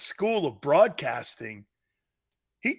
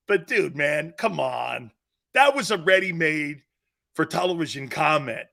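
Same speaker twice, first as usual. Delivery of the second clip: ordinary talk, nothing marked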